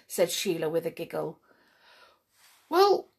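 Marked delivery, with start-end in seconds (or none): 2.7-3.1 higher, louder eager brownie voice; a small excited brownie; huffy little helper elf. Acted. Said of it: "said the brownie".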